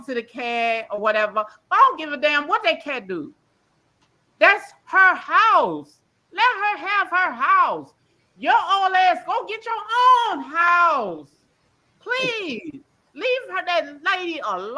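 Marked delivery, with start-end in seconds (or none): 4.4-7.9 louder, higher, each word dragged out; outraged, drawn-out insistence; slow emphatic anger. Real, not acted. Angry defense of the lady. 8.4-14.8 fed-up scolding aimed at Dovey; angry telling-off. Real, not acted.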